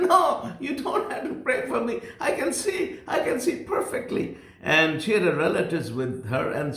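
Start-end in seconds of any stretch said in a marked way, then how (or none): 0.0-4.4 high laughing protest; too happy to need prayer; joyful. Retold or imitated. Healed woman refusing prayer.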